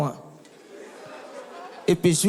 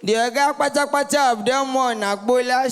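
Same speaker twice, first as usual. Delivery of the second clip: ordinary talk, nothing marked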